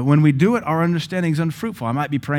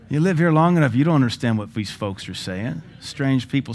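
ordinary delivery

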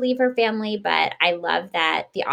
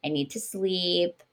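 softer and slow, mock exhausted; worn-out traveler; 5 dB quieter, slower than usual. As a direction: sag like someone who just crossed an ocean. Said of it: "Most people after that kind of a journey are like".

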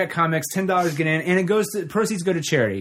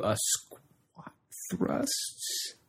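whispering; 6 semitones higher, 12 dB quieter, much slower than usual. Real, not acted. Whispered aside in promo.

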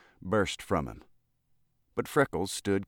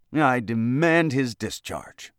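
slow, loud, high demand; indignant demand; 6 semitones higher, 7 dB louder, slower than usual. A narrator's reading. Lobbyist demands the boy's discharge, indignant. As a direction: bear down hard on every word. Irate lobbyist complains to governor.

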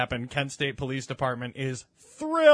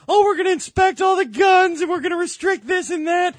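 much higher, louder mock voice with a shout; mocking Sam; 8 dB louder than usual. Mocking Sam promising gun inspections, shrill mock shout. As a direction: parody the coworker giving everything away. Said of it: "Sam on the other side of the table starts".

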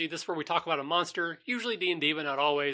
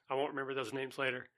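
ordinary talk

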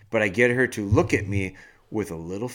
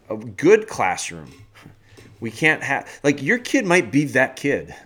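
ordinary talk, nothing marked

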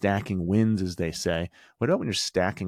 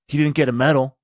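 higher and louder, imitating a bitter retort; mock angry retort; 7 semitones higher, 9 dB louder than usual. Bitter dad retorting to the coach, mock angry. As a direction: throw it back like a resentful dad.

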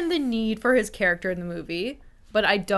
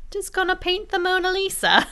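much higher breezy English voice; mock English accent; 12 semitones higher, 3 dB louder than usual. Da Vinci chatting in mock English accent. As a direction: toss it off like a casual Brit. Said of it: "he's very English".

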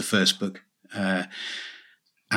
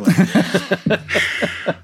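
laughing; 9 semitones higher than usual. Real, not acted. Laughing at own joke.

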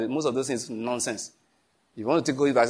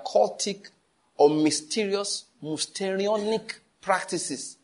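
slow emphatic reading; 5 semitones higher, much slower than usual. Real, not acted.

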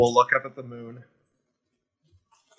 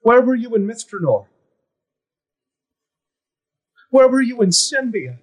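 much higher, louder, hurt and accusing; furious accusation; 12 semitones higher, 6 dB louder, faster than usual. Acted. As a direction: hurl each place at her in anger. Son confronts absent fairy queen.

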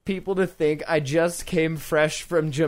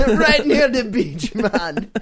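laughing, high mock Jamaican; hint of a Jamaican lilt; mock Jamaican accent; 3 semitones higher, slower than usual. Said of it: "What part of Jamaica?".

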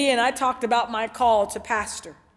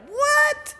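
high-pitched disbelieving what; acted bewilderment, voice leaping up; startled. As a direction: squeak it out in mock shock. Startled by God's voice while driving.

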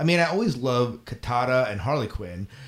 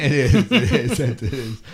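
loud, laughing agreement; 6 dB louder, slower than usual. Real, not acted. Co-host cracking up at the NyQuil joke, laughing. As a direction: agree through a burst of laughter.

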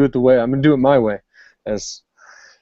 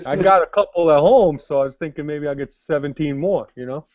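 put-on Jersey wiseguy accent; 4 semitones higher than usual. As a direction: play a Jersey tough guy shopping for turtles. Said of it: "how they say it in Jersey".

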